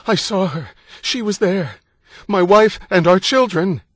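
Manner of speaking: high-pitched frantic pleading shout; desperate shouting. A narrator's reading. Husband to police about his family, shouting desperately. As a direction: plead loudly to get through. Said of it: "he shouted".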